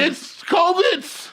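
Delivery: high, very slow, loud drawn-out answer; mock slow insistence. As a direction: stretch it out like it's obvious. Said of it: "mock answer".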